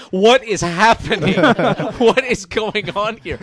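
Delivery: slow, bewildered disbelief; drawn-out amazed question at the pile of food. Retold or imitated. Zack Snyder bewildered by fans piling food on him, slow amazed disbelief.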